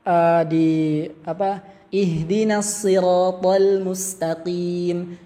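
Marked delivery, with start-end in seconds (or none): 1.9-5.2 slow chanted Quran recitation with Javanese accent; old Javanese-style reading. Shown as a demonstration. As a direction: recite it slowly the way it was read at the pesantren. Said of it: "Dulu saya bacanya ihdina".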